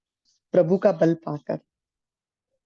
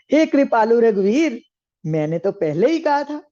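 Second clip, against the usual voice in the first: raised, higher-pitched protest to his lord; crying out in complaint; 6 semitones higher, 5 dB louder than usual. Acted. Sugriv runs back to complain to Lord Ram, crying out in protest. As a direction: call out loudly, then ease off a little. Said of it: "बहुत चिल्लाया है आकर प्रभु पर".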